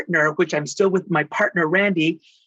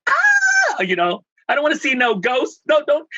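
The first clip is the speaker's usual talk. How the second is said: high squealing protest, no ghosts please; hammed-up frightened yelp; playful fake panic, pitched way up; mock scared squeal; 9 semitones higher than usual. Retells being a big chicken.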